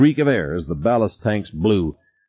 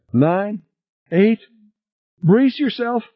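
high, tense warning; panicky countdown; slower than usual. A narrator's reading. Passenger warns crew to brace for the blast, high and panicky. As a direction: count down with mounting alarm.

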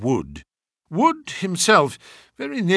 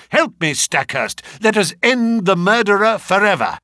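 raised voice, rising to a high, loud rallying call; urgent cry; 5 semitones higher, 4 dB louder than usual. A narrator's reading. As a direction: call a companion to action. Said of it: "I cried".

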